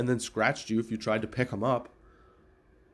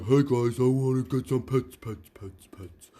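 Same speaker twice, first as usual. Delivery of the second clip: voicing the dog; slower than usual. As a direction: beg for pets like a big goofy retriever. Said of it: "he'd be like".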